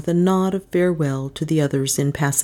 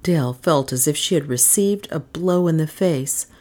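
plain reading voice

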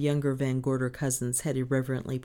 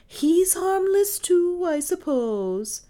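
slow, high, drawn-out sarcasm; mournful satire; 13 semitones higher, 6 dB louder, slower than usual. A narrator's reading. Maid mocking mistress's reassurance, mournfully sarcastic. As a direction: wail the sarcasm slowly. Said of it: "said Lizzie with mournful satire".

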